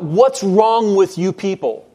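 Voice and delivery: slow, loud, high outburst of reproach; screamed rebuke. Retold or imitated. R C Sproul rebuking a conference audience, screamed out. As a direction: scold the whole crowd at full voice, drawn out. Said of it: "then he screams out".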